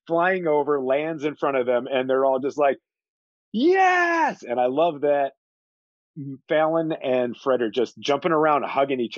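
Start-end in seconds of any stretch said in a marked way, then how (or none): 3.5-4.4 triumphant high yes; excited cheer. Retold or imitated. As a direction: cheer like schoolboys who saw something cool. Cheering as the head lands.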